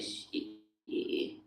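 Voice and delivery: breathy whispered shit; hushed imitated curse. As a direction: mutter it under your breath in dismay. Whispered reaction.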